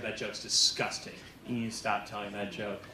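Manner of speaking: a hint of a disapproving grandma, hushed; angry old lady. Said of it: "She was really mad".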